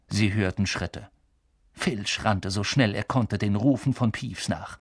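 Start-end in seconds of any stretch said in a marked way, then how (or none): none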